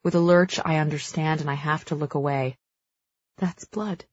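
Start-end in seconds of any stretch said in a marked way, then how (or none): 3.4-4.0 softer, stunned near-whisper; hushed whisper. A narrator's reading. Narrator's horrified girl at a torture scene, hushed. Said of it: "I whisper".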